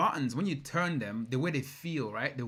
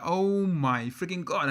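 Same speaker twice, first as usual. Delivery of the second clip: drawn-out, higher-pitched retold amazement; amazed; 5 semitones higher, 4 dB louder, much slower than usual. Car fan retelling first sight of the Divo, amazed. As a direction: stretch it out in awe, retelling your reaction.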